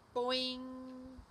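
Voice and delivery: high, loud, sustained boing; cartoon jump sound effect; springy boing. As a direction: ring out the boing like a bouncing spring. Frog jumps away.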